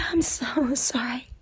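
hushed apology; whisper. Acted. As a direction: whisper it softly.

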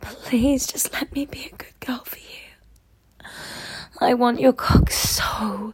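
pleading whisper. Acted. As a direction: beg in a whisper. Whispered begging.

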